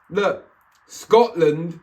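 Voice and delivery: normal talking voice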